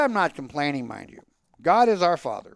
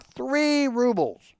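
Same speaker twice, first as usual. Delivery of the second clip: blurted cry; 5 semitones higher, much slower than usual. Acted. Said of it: "I cried out".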